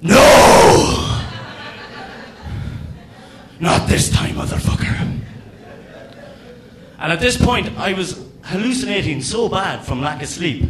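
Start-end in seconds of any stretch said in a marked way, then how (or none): 0.0-5.2 loud breathy warrior yell; hammed-up attack shout; mock battle cry. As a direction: roar it as you swing the racket. Attacking mosquito with racket.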